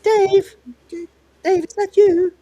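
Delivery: high, loud character voice; voice calling out. Retold or imitated. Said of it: "then you hear a voice goes".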